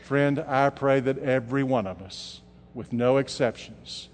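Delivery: low, soft preaching with long pauses; soft closing appeal. Real, not acted. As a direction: bring it down for the invitation.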